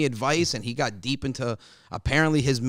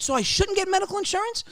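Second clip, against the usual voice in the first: much higher disbelief; incredulous question. Retold or imitated. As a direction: ask it stunned. Baffled at father.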